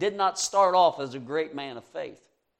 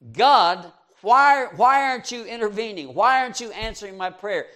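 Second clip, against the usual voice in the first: higher, louder pleading complaint; complaining to God; 5 semitones higher, 6 dB louder than usual. Retold or imitated. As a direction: whine up at heaven, demanding an answer. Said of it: "He was complaining to God".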